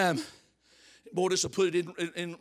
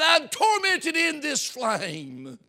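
slow, loud, high anguished cry from the flames; rich man crying out; 8 semitones higher, 6 dB louder, much slower than usual. Acted. Rich man in hell crying out, slow anguished shout. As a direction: wail it like a man burning. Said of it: "and he cried".

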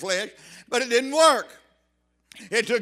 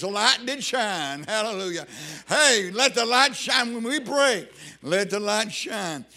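ordinary talk, nothing marked

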